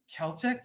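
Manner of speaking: "Celtic" as KEL-tik, rising high; high questioning "Celtic" with a K; hard-K pronunciation. Shown as a demonstration. Seller checking viewers' answers, "Celtic" rising, with a K. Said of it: "everyone's saying Celtic".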